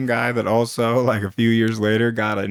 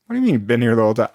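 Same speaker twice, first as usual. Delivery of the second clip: fast incredulous; much faster than usual. Retold or imitated. Incredulous retort.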